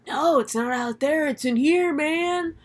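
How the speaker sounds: mock crazy conspiracy believer; crazy rambler. Ezra insists to Ward it is real, acted crazy insistence. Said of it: "just crazy person rambling bullshit".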